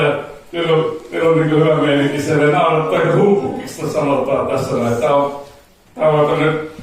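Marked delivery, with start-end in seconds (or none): none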